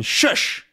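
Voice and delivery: sharp hushing sound; shushing. Acted. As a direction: shush the prisoners firmly. Tintin silences his prisoners.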